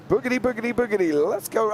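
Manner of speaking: high hyped-up catchphrase call; Darrell Cartrip catchphrase. Retold or imitated. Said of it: "I got it actually out of Lightning McQueen".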